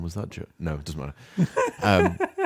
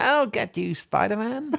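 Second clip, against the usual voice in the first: much higher cartoon villain threat; 4 dB louder, slower than usual. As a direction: shriek it like a comic book baddie. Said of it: "it's him again".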